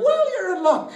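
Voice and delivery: cartoon leprechaun making an offer. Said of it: "So the leprechaun looks at him".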